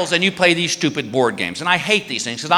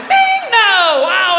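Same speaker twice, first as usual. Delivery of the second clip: high, loud mocking buzzer; mock her guess sarcastically; sarcastic ding; 12 semitones higher, 5 dB louder, much slower than usual. Husband sarcastically rejecting wife's guess. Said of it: "And I go".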